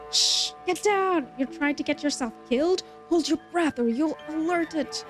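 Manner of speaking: tense, hurried hunter warning; urgent hunter. Acted. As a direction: shush them and urge them to hide. Voicing hunter warning in game.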